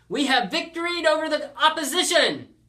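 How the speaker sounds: high, very loud victory yell; shouted proclamation. A narrator's reading. Player restating the win in perfect tense, shouted. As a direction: shout each word as a grand triumph. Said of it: "he should have shouted".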